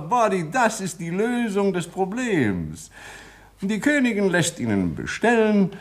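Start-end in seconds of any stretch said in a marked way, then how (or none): none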